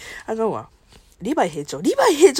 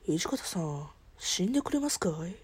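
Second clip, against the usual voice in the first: Okita impression; 4 semitones lower, 9 dB quieter than usual. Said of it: "沖田総合".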